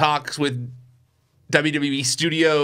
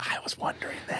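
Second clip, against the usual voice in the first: whisper; 13 dB quieter than usual. Real, not acted. Conspiratorial aside about WWE motives.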